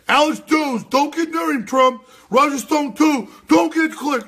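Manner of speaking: high, loud shouted parody; mock warning. Host parodying critics warning people off, high and shouting. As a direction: yell it like a panicked scold. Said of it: "they mention".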